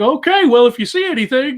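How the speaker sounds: high, loud mock-agreeable reply; baffled game warden. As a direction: play along without understanding, about to laugh. Acting out the uncomprehending warden.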